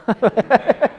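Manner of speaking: a short burst of real laughter. Real, not acted. Speaker laughing at own parenting struggles. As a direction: laugh at yourself, caught out.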